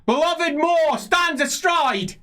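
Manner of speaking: high, loud, slow proclamation; confused prophesying priest. Acted. Confused priest continuing to the crowd, loud prophetic cry. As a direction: cry it out as if the words are hitting you. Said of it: "he says, looking confused".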